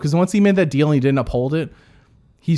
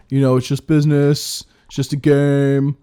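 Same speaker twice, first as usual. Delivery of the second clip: a louder, slowed Rob; playing Rob; 5 dB louder than usual. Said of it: "I'll be Rob".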